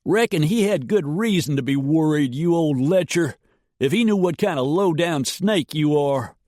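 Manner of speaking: high, loud drawled yell; yelled insult. A narrator's reading. Hobie yelling at Dietrich from the canyon. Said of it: "Hobie yelled".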